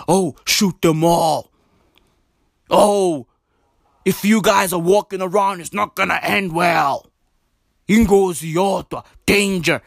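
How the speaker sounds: lightly voiced stern official; mocking officials. Vlogger parodying the task force's threats, mock stern. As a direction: put on a menacing official's warning. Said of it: "They always use fighting words".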